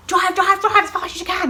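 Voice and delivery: high, loud retold plea to hurry; urgent yell. Telling Danny to drive to hospital, urgent high yell. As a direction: act out frantic driving orders.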